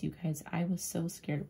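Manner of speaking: usual speaking voice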